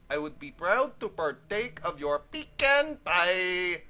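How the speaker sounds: slow, put-on silly voice; comic impression, slowly stretched words; [name]'s funny voice. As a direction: draw it out in a goofy movie-quote voice. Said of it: "[name] is talking in a funny voice".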